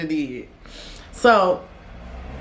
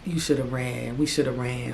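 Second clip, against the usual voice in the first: lower, softer regretful mutter; low grumble; 7 semitones lower, 8 dB quieter than usual. Retold or imitated. Ex muttering regret on the walk to the back, low and soft. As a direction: grumble it under your breath.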